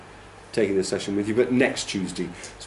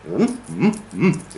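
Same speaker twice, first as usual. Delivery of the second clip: hummed "mm"s with big peaks and troughs; hummed English rhythm; 4 semitones higher, slower than usual. Shown as a demonstration. Teacher shows how English speakers say it, hummed peaks and troughs. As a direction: hum swooping stressed beats. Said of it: "big peaks and, and troughs".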